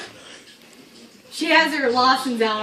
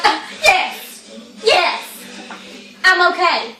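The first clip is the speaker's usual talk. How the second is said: imitated flustered insistence; 6 semitones higher, slower than usual. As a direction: imitate a rattled driver insisting to the cop. Friend insisting she can drive.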